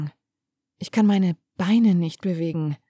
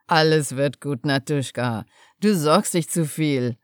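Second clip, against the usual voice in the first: lower, slower father voice with a smile; father's voice; 5 semitones lower than usual. A narrator's reading. Father reassures his worried daughter, lower and smiling. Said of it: "mit einem Lachen in seiner Stimme".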